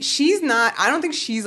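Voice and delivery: usual speaking voice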